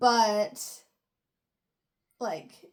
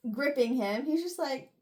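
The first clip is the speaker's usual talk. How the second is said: ordinary delivery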